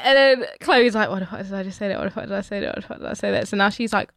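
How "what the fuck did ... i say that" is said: soft, repeated self-reproach; hushed mock panic; panicked inner thought. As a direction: fret under your breath, replaying the blunder. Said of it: "pranging out".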